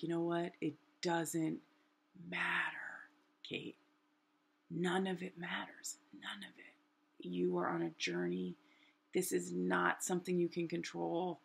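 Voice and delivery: soft, soothing near-whisper; relaxed self-talk. Retold or imitated. Floating in the pool, telling herself to relax, hushed. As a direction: talk yourself down, hushed and gentle. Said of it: "relax, and I start to be like".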